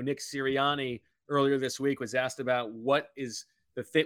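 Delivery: ordinary talk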